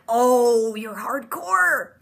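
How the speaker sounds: slow, drawn-out awe; impressed reaction. Retold or imitated. Vlogger watching Delphine pull a razor blade, drawn-out impressed awe. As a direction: savor the words, half-mocking admiration.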